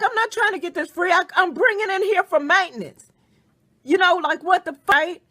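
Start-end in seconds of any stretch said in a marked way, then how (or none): 0.0-3.1 high, exasperated protest; mock-offended husband, voice raised high; indignant husband. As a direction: retell his outraged disbelief, pitched up. Husband's indignant retort, retold.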